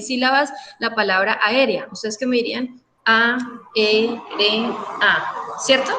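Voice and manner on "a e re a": "A, E, R, A" as four beats a, e, re, a; separated syllables. Shown as a demonstration. Teacher voicing the expected answer, syllables separated. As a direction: give each syllable of "A, E, R, A" its own beat. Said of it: "Me la separan".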